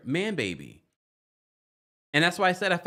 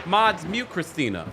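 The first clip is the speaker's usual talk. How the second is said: mock order; 5 semitones higher, 4 dB louder than usual. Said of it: "I'm joking".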